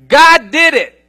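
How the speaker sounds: loud, high declaration; preacher shouting God did it; proclaiming shout. Real, not acted. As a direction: hammer it home to the crowd.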